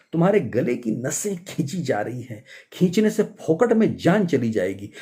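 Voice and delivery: animated mock-complaint to classical musicians; exasperated stage-poet delivery; comic poem recitation. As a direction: recite satirical verse with swooping emphasis.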